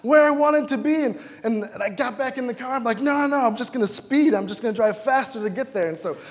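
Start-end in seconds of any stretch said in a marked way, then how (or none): 0.0-1.0 higher, louder flustered complaint; frustrated outburst. Retold or imitated. Preacher stuck on the bridge, almost furious. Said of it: "I'm almost furious". 3.0-5.9 higher, louder resolve to speed; determined frustration. Retold or imitated. Preacher deciding to drive faster, frustrated. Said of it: "I'm almost furious".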